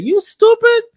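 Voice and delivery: high, mocking jab; scornful jab. Real, not acted. Mocking Kim for wanting a fake apology video, scornful.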